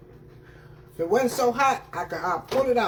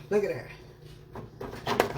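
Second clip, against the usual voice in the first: whispered; 5 dB quieter, much slower than usual. Real, not acted. Showing ribs, hushed slow.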